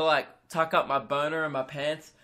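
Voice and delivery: usual speaking voice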